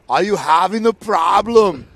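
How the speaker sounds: stern German Coast Guard, dragged out slowly; German accent. Retold or imitated. Joke's German Coast Guard hailing the boat, slow mock German accent. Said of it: "he, he goes".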